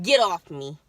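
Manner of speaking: loud sharp brush-off; snapping get off me. Retold or imitated. Pushing mom away, loud retort. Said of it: "and I'm like".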